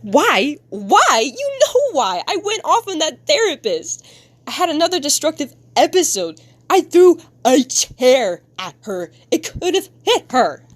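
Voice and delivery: high, agitated acted rant; upset outburst. Kid confessing an episode to parent, upset high outburst. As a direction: build from sharp questions into high-pitched self-reproach.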